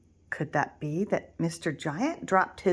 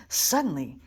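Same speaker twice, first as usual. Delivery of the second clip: ordinary delivery, nothing marked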